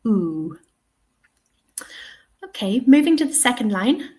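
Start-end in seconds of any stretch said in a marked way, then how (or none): 0.0-0.6 oo held long, as in "two". Shown as a demonstration. Teacher shows students the dotted symbol, long oo. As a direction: stretch out the vowel oo. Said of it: "so it's nice and long".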